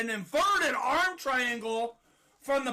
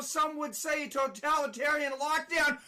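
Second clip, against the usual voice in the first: normal talking voice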